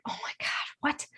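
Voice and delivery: hushed, disbelieving oh my God; stunned whisper. Retold or imitated. Retelling her shock to viewers in a whisper. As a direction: breathe it out in a whisper. Amazed reaction retold to viewers.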